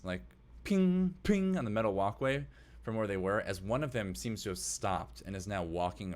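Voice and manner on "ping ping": metallic footstep pings. Acted. Slower footsteps on the metal walkway, imitated pings. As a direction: sound out slow steps clanging on metal. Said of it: "you hear slower footsteps".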